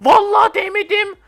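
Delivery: loud, high indignant shout with a laugh; laughing protest. Real, not acted. Claiming he never touched it, laughing indignant shout. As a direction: protest your innocence at the top of your voice.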